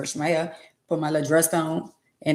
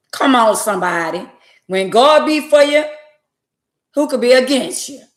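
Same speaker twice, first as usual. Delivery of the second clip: loud, high testifying cry; churchy shout of praise; preaching praise; 5 semitones higher, 7 dB louder than usual. Real, not acted. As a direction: preach it out to the congregation. Church-style praise in story.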